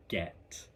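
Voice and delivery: "get" with a broad open e, soft and low; "get" said broad and fading; broad British get. Shown as a demonstration. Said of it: "Very broad".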